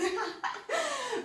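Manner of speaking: high, quiet giggle; amused laugh. Real, not acted. Dance teacher amused by her own wrong demonstration, high soft laughter.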